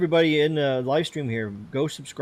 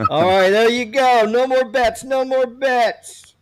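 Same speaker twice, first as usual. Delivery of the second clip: casino dealer call; 10 semitones higher, 5 dB louder, slower than usual. Acted. Spinning the giveaway wheel.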